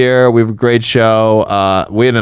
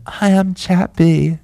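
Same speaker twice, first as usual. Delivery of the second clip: silly character voice; 7 semitones higher, slower than usual. Pretend. Jokey character intro.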